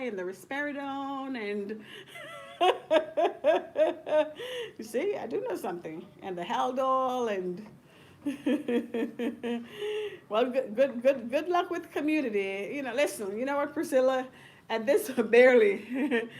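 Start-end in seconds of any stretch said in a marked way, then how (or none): 1.8-4.7 loud burst of laughter; big laugh. Real, not acted. Laughing at her own boast to Priscilla. 14.7-16.4 words broken by laughter; laughing. Real, not acted. Laughing while advising Priscilla.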